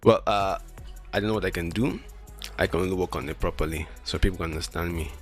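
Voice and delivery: ordinary delivery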